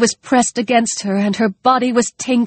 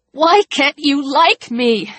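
voice breaking; 7 semitones higher than usual. Acted. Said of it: "his voice breaking".

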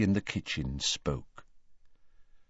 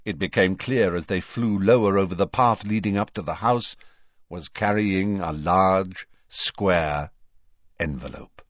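usual speaking voice

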